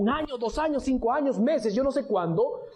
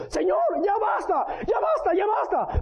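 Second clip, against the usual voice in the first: much higher cry of ya basta; desperate cry; 9 semitones higher than usual. Acted. David begs God to stop the plague, high cry. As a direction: shout it up high, begging for an end.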